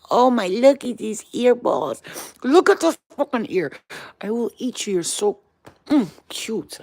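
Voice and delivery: loud, adoring pet talk. Real, not acted. Owner admiring the dog's ears, gushing baby talk. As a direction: gush as if you could eat them up.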